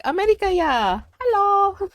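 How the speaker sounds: high, sing-song meme voice. Retold or imitated. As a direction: quote the meme in a silly high voice. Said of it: "everyone was all like".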